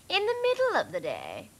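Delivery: very high, prim dog voice; third Labrador. A narrator's reading. Third Labrador finishing the point, very high voice. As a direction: squeak it out like a prissy dog. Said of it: "said the third".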